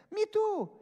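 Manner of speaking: very high, loud mock enthusiasm; eager agreement. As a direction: pipe up loudly, imitating delight. Retold lady eagerly agrees.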